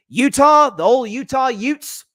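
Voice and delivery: slow, loud Southern twang on Utah Utes; Southern accent. Retold or imitated. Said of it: "had to wax poetic about in a Southern accent".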